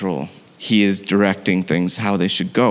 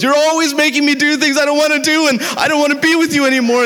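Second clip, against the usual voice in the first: much higher, fast panicked rant; freaking out; faster than usual. Retold or imitated. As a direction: voice the boyfriend losing it mid-ride, high and rushed. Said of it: "the guy is just freaking out".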